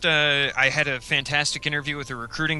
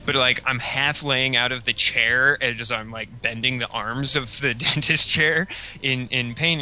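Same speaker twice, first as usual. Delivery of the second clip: normal talking voice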